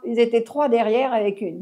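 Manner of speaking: usual speaking voice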